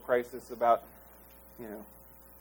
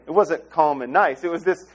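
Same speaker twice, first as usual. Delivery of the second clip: ordinary talk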